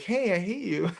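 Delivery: wife's voice, put on high; imitated scared wife. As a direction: mimic a wife who just got scared. Wife's retort after being scared, retold.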